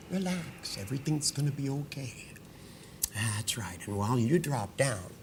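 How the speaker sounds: soft, steady hypnotist voice guiding her down; hypnotic induction. Acted. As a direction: lull her to relax, softly. Hypnotist leads a relaxation induction.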